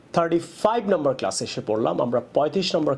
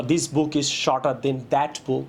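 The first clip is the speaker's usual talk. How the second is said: very slow teaching read; much slower than usual. Shown as a demonstration. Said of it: "অথবা".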